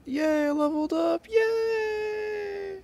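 very high, drawn-out squealed yay; high-pitched playful victory cry; celebratory cheer. Pretend. Cheering a level-up in the game.

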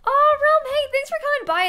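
high, loud, bubbly hello; excited greeting. Real, not acted. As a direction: greet them with a burst of joy.

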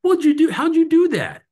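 high, loud mock astonishment; hammed-up squeal of amazement; amazed outburst. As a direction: play the driver bowled over, voice shooting way up. Acts out amazed stranded driver.

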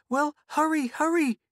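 put-on high, urgent little-girl cry; impatient cry. A narrator's reading. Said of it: "cried Mink".

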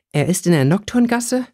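high, alarmed question; disbelieving worry; worried. A narrator's reading. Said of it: "war Theresa besorgt".

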